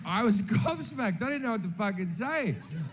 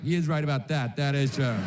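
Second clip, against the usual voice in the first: low-pitched imitation of won-over passengers; grudging agreement; 8 semitones lower, faster than usual. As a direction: concede the point, voice dropped low. Won-over passengers concede the point.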